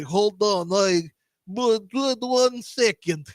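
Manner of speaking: drawn-out fake Russian stalling; bad Russian accent. Caught bluffer asks visitor to wait, bad Russian accent. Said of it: "I'm doing a bad Russian accent".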